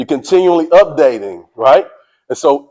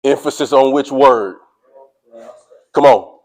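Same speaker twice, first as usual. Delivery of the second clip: ordinary talk, nothing marked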